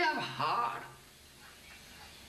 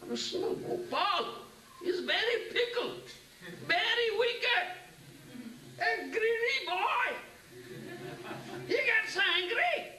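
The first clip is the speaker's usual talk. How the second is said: much higher, half laughing, half crying; fond laughing complaint; 12 semitones higher than usual. Retold or imitated. Father Nanda laughs tearfully about his greedy little Krishna, high voice. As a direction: scold the naughty boy with tearful delight.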